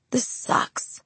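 whispered through tears; tearful whisper. A narrator's reading. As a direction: breathe it out, teary. Crying in his arms, whispered.

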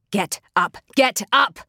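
urgent command rising to a loud shout; forceful, escalating angry order; spat order. A narrator's reading. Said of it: "she spat at Tekawa".

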